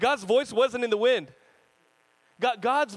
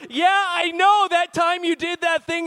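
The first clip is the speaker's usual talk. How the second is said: booming, much louder over dinner talk; loud friend voice; 8 semitones higher, 10 dB louder than usual. Retold or imitated. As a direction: blurt it out like the loud friend at the table. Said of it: "they're like".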